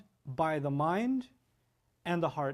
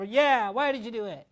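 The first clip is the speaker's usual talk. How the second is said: put-on higher, louder copycat kid; little child copying; 5 semitones higher, 5 dB louder than usual. Little one joins parent's telling-off, higher and louder. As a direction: chime in like a bossy little one. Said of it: "one of my little ones comes over".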